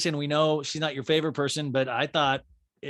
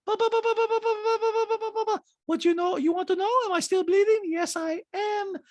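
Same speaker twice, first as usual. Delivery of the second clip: high mocking impression with babble; [name]; 4 dB louder than usual. [name] telling Sutton of her loss, high mock impression. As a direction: mimic her way up high, rattling on. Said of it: "Dinah goes".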